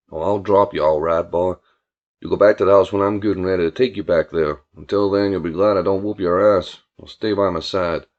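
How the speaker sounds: low-pitched country tough; stern, threatening Billy. Acted. Billy threatening his complaining cousin in the car, stern low voice. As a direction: lay down the law in a low voice.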